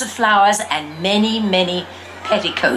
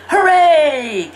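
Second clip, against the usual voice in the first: delighted cheer; 7 semitones higher, 4 dB louder than usual. A narrator's reading. Girl gets her wings and crown.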